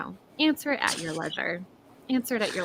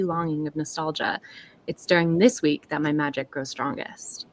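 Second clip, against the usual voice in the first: story narration; 4 semitones lower than usual. A narrator's reading. Author reading her opening paragraph to the chat, gently narrated. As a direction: savour each image like a storyteller.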